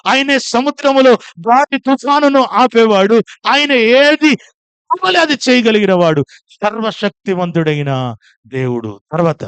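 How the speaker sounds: preacher shouting praises, congregation answering; full-force preaching. Acted. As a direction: thunder out God's titles to the crowd. Call-and-response proclaiming God's names.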